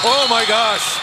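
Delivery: oh my gosh, then a laugh; amazed by the goal; stunned. Real, not acted. Reacting to a goal.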